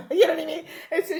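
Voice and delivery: laughing through the question. Real, not acted. Laughs at Oprah's reply.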